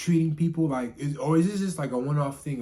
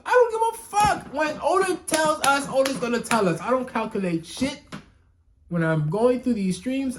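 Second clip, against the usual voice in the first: much higher, louder fed-up ranting; exasperated rant; 11 semitones higher, 4 dB louder than usual. Real, not acted. Reviewer ranting at chat about spoiler theories, loud exasperation. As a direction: vent it, you truly don't care.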